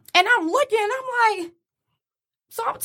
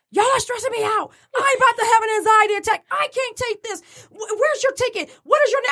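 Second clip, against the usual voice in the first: higher-pitched mock agitated complaint; flustered anger; 7 semitones higher than usual. Gate agent snapping at passengers over boarding, imitated flustered anger. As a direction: imitate a stressed-out agent losing patience. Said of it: "she wants to get angry with us".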